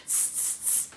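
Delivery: monkey tsk. Acted. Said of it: "and said".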